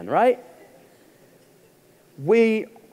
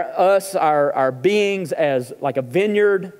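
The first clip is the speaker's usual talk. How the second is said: normal talking voice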